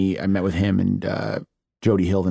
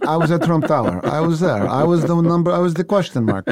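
higher, put-on Russian character voice; comic Russian-accented voice, pitched up; Ivan; 6 semitones higher than usual. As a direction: play a boastful Russian insisting he was there. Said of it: "Ivan right now".

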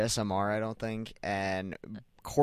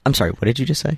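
ASMR whisper; 4 semitones higher, 13 dB louder, faster than usual. Pretend. ASMR bit, mock shock.

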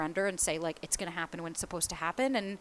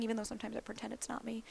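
secretive whisper; conspiratorial whispered admission; whispered aside; 2 semitones higher, 11 dB quieter, faster than usual. Real, not acted. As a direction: confide it in a hushed whisper. Confiding a secret.

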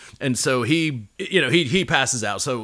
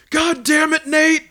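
high, loud furious shout; angry yell; 8 dB louder, slower than usual. Retold or imitated. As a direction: bellow it at the culprit. Friend yelling at discovery.

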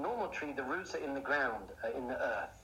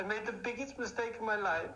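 higher-pitched mock-crying ex-girlfriend; imitated tearful plea; 7 semitones higher than usual. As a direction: plead as if lightly tearful, pitched up. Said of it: "she's crying".

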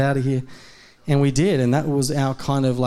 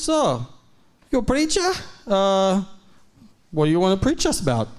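high, lightly voiced Russian man; imitating Nikolai; 6 semitones higher than usual. Nikolai asking the preacher for a sermon, high curious voice. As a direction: ask it like a curious old neighbour.

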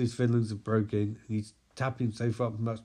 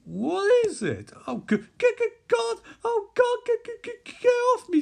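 high, stammering panicked shriek; freaking out; 5 dB louder than usual. Acted. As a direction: stammer in terror, fighting something off. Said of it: "freaking out through fear gas".

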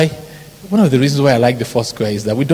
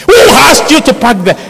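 much higher, louder mock scolding; angry organizer; 13 semitones higher, 9 dB louder than usual. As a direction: shout it like an outraged usher. Organizer scolds him over parking.

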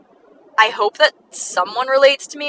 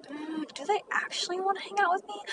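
small, high, unsure murmur; quietly second-guessing, voice raised and soft; doubtful inner thought; 10 semitones higher, 15 dB quieter than usual. Retold or imitated. Overthinker wondering about a friend's reply, doubtful. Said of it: "I would just be like".

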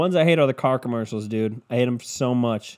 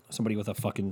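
ordinary talk

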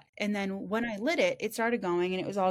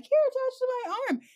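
high, cheery little offer; mock cheerful acceptance; 3 dB louder, faster than usual. Retelling the oil slick, cheery high. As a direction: chirp it like nothing is wrong. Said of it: "I'm like".

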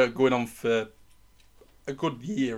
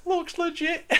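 put-on much higher, slow, childish squeak; squeaky kid voice; slower than usual. Mocking the brother who got the fake voucher, in a squeaky kid voice. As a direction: squeak it like a gullible little kid. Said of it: "He's like".

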